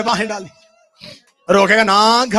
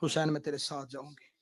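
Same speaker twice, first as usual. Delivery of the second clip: tearful plea; 8 semitones lower, 18 dB quieter than usual. Acted. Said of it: "रो के कहती".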